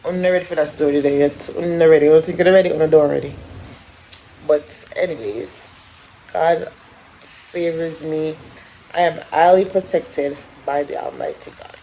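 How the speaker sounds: ordinary delivery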